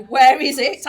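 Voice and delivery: loud, teasing demand shouted out; mock demand. Clinician teasingly demanding her patient's missing hosiery, loud. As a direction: bellow it like a playful telling-off.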